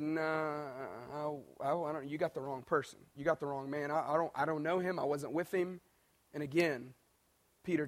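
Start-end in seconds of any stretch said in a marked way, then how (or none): 0.0-5.8 low, hushed brush-off with halting starts; acted low-key denial. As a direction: play Peter quietly backing away from the accusation. Peter nervously denying knowing Jesus.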